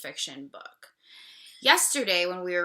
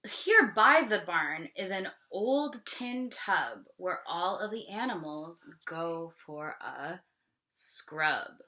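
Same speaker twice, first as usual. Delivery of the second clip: ordinary talk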